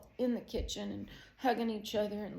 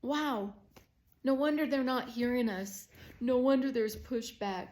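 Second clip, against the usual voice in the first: higher, louder, emotional lament; anguished realization; 5 semitones higher, 6 dB louder than usual. Real, not acted. Mom realizing why kids push back, anguished. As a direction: cry it out with rising conviction.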